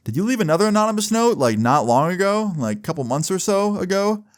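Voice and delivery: questioning teacher. Retold or imitated. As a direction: lift it high, quick start, as a suspicious question. Teacher voiced questioning the teller.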